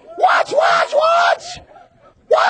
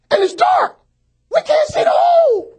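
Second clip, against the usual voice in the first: higher, louder cry on hole; preaching shout; 13 semitones higher, 3 dB louder than usual. Acted. Pastor preaching to congregation, shouting. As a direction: shout it to the back pews.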